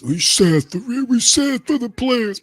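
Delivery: high, slow whiny sob-sister voice; mocking high whimper; mock sympathy. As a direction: squeak it out like a sappy fan pitying players.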